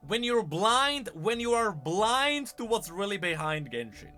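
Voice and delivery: usual speaking voice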